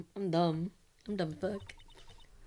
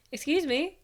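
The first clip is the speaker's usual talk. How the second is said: bright calling out; 7 semitones higher, 5 dB louder than usual. Acted. Maya calls again.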